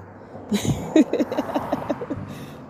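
breathy high laugh after a joke; real unvoiced giggle; amused laugh. Real, not acted. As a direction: laugh at your own line. Laughs after own retort.

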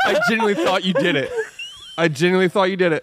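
fast, high, shaken laugh. Real, not acted. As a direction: laugh off a real scare. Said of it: "He almost cried".

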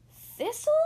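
high, loud rising name; astonished question. A narrator's reading. Goose recognizes Thistle in costume, astonished. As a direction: squeak the name in disbelief.

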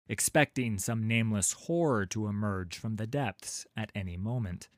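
ordinary delivery, nothing marked

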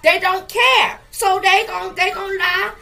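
fired-up pulpit cadence; preaching at full force. Acted. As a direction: rise into the climax of the sermon.

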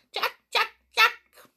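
very high, loud bird cries; jackdaws calling. A narrator's reading. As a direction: squawk out like jackdaws. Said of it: "calling loudly".

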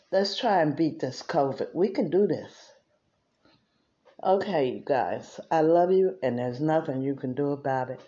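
breathless after dancing; out of breath. Real, not acted. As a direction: talk while catching your breath. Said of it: "I'm talking like this 'cause I'm out of breath".

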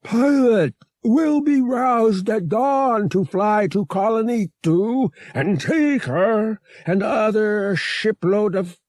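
high, slow, pressing alien voice; a giant alien rushing to explain, building to a shout; urgent alien. A narrator's reading. Murg urges Han to escape now, high, slow and urgent, rising to a shout.

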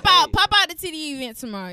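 loud promo shout. Real, not acted. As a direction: call everyone out loudly.

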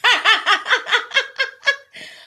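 high, loud burst of real laughter; laughing hard. Real, not acted. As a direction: crack up at the chat. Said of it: "the chat got me over here in tears".

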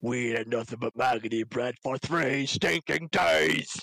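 slow, snarling orc complaint; hammed-up movie orc. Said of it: "I can do a really good orc".

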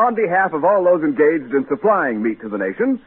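usual speaking voice

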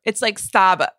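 thick Boston accent, no R. Shown as a demonstration. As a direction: say it like a Bostonian, R dropped. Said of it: "with a really thick Boston accent".

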